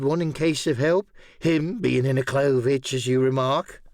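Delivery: lightly voiced sly castaway; a hint of a bargaining hermit; Ben Gunn. A narrator's reading.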